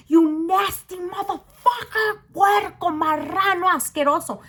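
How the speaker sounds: going off. Retold or imitated. Teller to the man touching her friend, going off. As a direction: tear into him with disgust, word by word. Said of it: "I'm going off on this motherfucker".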